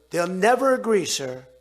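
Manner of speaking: a half-shouted retold warning to the president; mock loud protest. Drug companies loudly warn the president it will never happen. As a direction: insist loudly that it can't happen.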